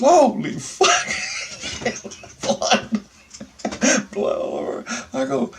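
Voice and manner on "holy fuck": high, loud, slow shocked cry with a laugh. Retold or imitated. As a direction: gasp it out at all the blood. Cousin sees the blood.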